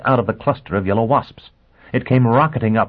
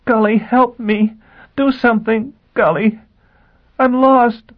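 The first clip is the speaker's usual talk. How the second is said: desperate plea; 6 dB louder than usual. Acted. Gisbella begging in despair.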